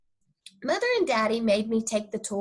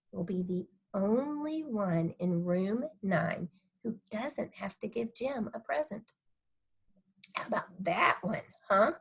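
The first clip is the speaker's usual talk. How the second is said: softer, hushed teasing father; soft coaxing dad; 7 dB quieter than usual. Acted. Dad quietly tempting Junie B to stay home. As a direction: coax her gently with a quiet grin. Daddy soft voiced tease.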